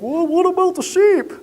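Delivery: high, fretful put-on voice of a worrier; fussing shepherd asks about the sheep, high and fretful; critical shepherd. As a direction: play the nagging one in a high whine. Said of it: "always the critical one, he's like".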